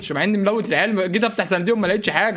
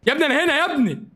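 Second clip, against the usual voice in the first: high, pleading cries; high-pitched cry while dying in game; urgent calling for help; 10 semitones higher than usual. Real, not acted. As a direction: call out high to a teammate.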